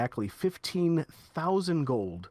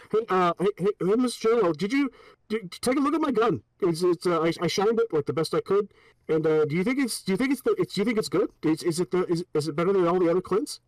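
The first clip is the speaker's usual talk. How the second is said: needy guard; 2 semitones higher, 4 dB louder than usual. Acted. Clint guard asking if his gun is the best, eager and loud. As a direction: beg for approval like a puppy. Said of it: "you sound like a fucking dork".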